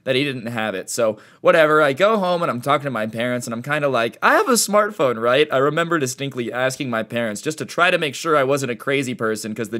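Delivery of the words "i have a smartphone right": unsure. Retold or imitated. Asking parents anxiously.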